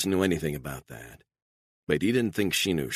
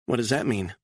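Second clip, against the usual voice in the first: a touch higher, subdued; lightly voiced woman; 5 semitones higher than usual. A narrator's reading. Woman telling her captor about her custody loss, lightly voiced.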